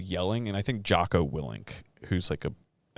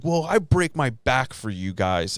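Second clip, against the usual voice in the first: mock whiny complaint; 5 semitones higher, 5 dB louder, slower than usual. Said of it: "And he's like".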